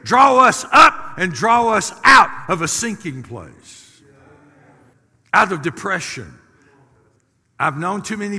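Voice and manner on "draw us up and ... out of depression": high, very loud preacher cry; full-force preaching. Real, not acted. Pastor rousing a congregation with God's Word, full-force preaching.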